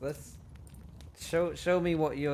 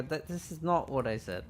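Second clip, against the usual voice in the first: ordinary talk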